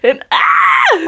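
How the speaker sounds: a sudden high scream of frustration; overwhelmed book-fan shriek; real frustrated shriek. Real, not acted. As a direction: let out a real shriek over the ending. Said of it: "I'm still not over it, as you can clearly tell".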